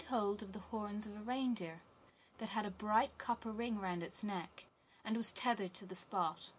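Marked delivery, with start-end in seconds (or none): none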